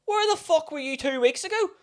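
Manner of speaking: high-pitched accusing question; mock indignant demand. Customer confronting his waiter after a missed week, mock indignant. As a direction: imitate an exasperated regular.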